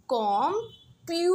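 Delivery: three separate beats in computer, the middle प्यू stretched most; stress on PYU, weak ends. Shown as a demonstration. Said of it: "कम और टर पे मैं बहुत कम stress".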